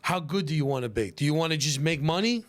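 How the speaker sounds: normal talking voice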